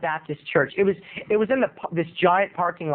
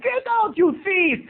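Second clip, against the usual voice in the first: very high, exasperated mock Indian accent; frantic shop owner, hammy Indian accent; 13 semitones higher than usual. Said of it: "giving this guy an Indian accent".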